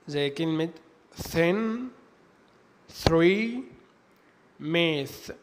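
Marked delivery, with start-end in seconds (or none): 1.2-5.3 "thin", "three", "myth" with th like Arabic ثاء; unvoiced th. Shown as a demonstration. Teacher giving examples of tha sound, "thin", "three", "myth" with unvoiced th. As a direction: model each word separately, "thin", "three", "myth", tongue between the teeth for unvoiced th. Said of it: "زي حرف الثاء في اللغة العربية".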